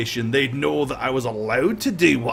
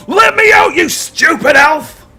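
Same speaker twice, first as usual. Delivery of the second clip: much higher, louder roar, stretching the insult slowly; furious shout; 13 semitones higher, 10 dB louder than usual. Acted. Caged prisoner yelling at the vanished elf, furious roar. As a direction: scream it at the elf who tricked him. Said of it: "he goes".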